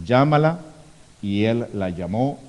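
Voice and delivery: normal talking voice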